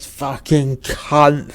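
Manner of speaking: put-on very slow, strained drunken curse; forced-out words. A narrator's reading. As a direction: force each word out with great effort, dragging it. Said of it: "able to force out the word".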